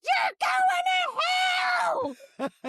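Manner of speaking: high, drawn-out mock shriek; slow, piercing retold yell; imitated heckler's scream. Friend's heckle at State House.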